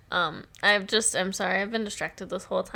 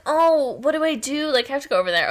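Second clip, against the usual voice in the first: anxious fretting; 5 semitones higher, 5 dB louder than usual. Retold or imitated. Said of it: "she's like".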